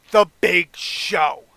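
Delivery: loud, slow drawn-out announcement; big reveal. Acted. Announcing Raw's final pick, loud dramatic reveal. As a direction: boom it out word by word.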